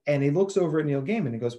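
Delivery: normal talking voice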